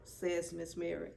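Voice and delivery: ordinary delivery, nothing marked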